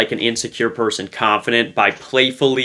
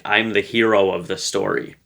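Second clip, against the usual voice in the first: ordinary talk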